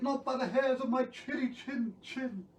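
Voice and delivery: high, squeaky little pig voice; little pig refusing. Acted. Second pig refusing the wolf at the door, high pig voice. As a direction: pipe up high as a defiant piglet. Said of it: "And he said".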